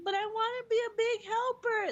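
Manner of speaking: high, whiny imitation of a crying kid; mock tearful child. Daughter crying to be the helper, high mock tearful wail. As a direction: wail it like an upset toddler. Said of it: "And then she starts to cry".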